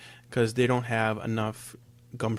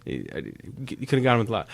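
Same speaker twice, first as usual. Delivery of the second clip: ordinary talk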